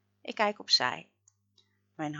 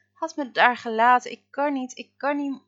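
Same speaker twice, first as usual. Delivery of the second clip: higher, louder tearful pleading; crying; 6 semitones higher, 8 dB louder than usual. A narrator's reading. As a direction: beg through tears. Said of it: "begint Freek te huilen".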